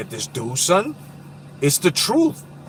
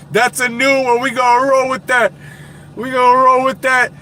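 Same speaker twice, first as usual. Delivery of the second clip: higher, louder, amused; excited quote; 8 semitones higher, 5 dB louder than usual. Retold or imitated. Streamer quoting his friend, amused and raised. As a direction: hype the friend's line.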